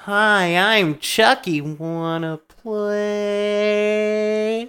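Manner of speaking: high, slow toy doll voice; sweet toy voice; talking doll. Retold or imitated. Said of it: "the doll head goes".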